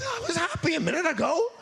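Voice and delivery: high, laughing, whiny inner voice; mock wail of lost happiness, laughing; laughing mock whine. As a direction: squeak it out through laughter like a baffled kid. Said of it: "It's like".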